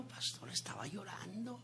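hushed whisper; whispering to the pastor that the wife was crying. Real, not acted. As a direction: lean in and whisper it. Confiding to the pastor.

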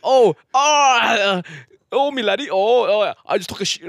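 high, loud attempted Scottish accent.

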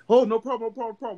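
the worker scrambling to back off; mock nervous worker. Mimics worker backing down.

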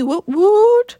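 high, playful "woot woot" cheer; celebratory whoop. Pretend. Host hyping her first podcast episode, playful whoop. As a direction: cheer yourself on with a rising whoop.

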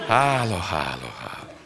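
hangosan indul, suttogásig halkul; elhaló hálálkodás. A narrator's reading. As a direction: egyre halkabban, megkönnyebbülten. Megkönnyebbülés, Karcsika fent van. (fogyó hangerejű hála)

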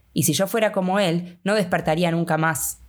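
usual speaking voice

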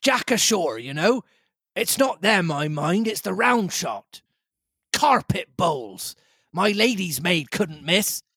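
high, urgent captain voice; captain warning not to swamp the boat; captain's voice. Acted. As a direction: warn the squire like a tense sea captain. Captain talking in crisis.

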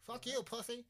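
loud, high taunting jeer; mocking taunt. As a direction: jeer at the host. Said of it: "taunting the host".

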